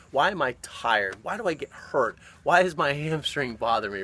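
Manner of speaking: ordinary delivery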